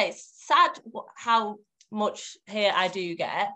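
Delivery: normal talking voice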